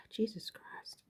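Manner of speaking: breathy whisper under a sigh; whispered oath. Real, not acted. Vlogger catching herself swearing, whispered.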